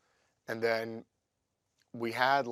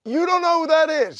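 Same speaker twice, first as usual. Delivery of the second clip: much higher and louder, disbelieving; hammed-up shout of disbelief at Oz; mock exasperated yell; 13 dB louder than usual. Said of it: "I go".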